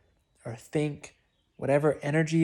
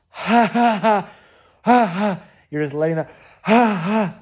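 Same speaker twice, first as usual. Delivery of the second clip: loud, high, forced ha ha bursts; forced laughter; 5 semitones higher, 9 dB louder than usual. Shown as a demonstration. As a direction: push the laugh out hard and loud. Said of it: "letting out laughter at first".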